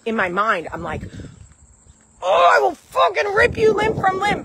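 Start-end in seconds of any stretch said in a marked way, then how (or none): 2.2-4.4 much higher, louder mock-furious threat; exaggerated inner rage. Mom voices the rage in her mind, over-the-top furious. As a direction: ham up the inner monster ready to tear everyone apart. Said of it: "the way I defend myself is so aggressive".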